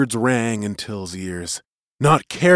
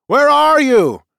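high, loud searching call; 9 dB louder, slower than usual. A narrator's reading. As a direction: call out for someone who is missing. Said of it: "he called".

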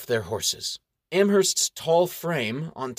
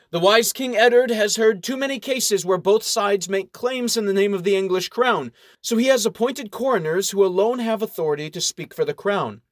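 stately official; 7 semitones higher, 4 dB louder than usual. Acted. Official explains.

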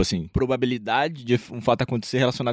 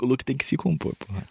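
ordinary talk

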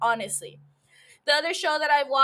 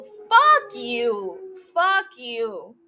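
blunt angry retort; 4 dB louder, much slower than usual. Real, not acted. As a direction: say it flat out and hard, a touch louder.

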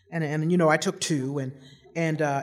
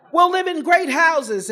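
higher, louder, slow mock-solemn vow; grand promise; 9 semitones higher, 6 dB louder, slower than usual. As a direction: promise the family glory, laughing.

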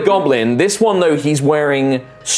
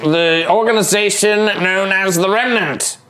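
higher, condescending goblin voice, touches of threat; smart goblin; 5 semitones higher than usual. Acted. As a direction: sound like a clever goblin tired of fools. Goblin denies affiliation.

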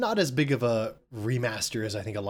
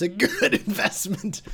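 laughing; 9 semitones higher, 5 dB louder, slower than usual. Real, not acted. Laughing while recommending.

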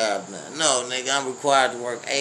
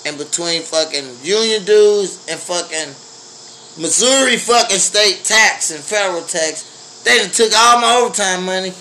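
loud, higher furious complaint; angry rant; 6 semitones higher, 4 dB louder than usual. Real, not acted. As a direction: vent it hot and loud. Ranting about taxes.

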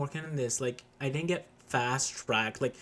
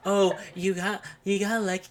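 mocking whine; 5 semitones higher than usual. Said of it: "pisses me off when people are like".

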